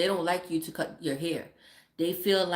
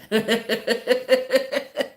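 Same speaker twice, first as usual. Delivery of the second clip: long, high run of laughter; laughing; 5 semitones higher, 7 dB louder than usual. Real, not acted. Mother amused at her kids' expense, laughing. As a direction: keep cackling at your own joke.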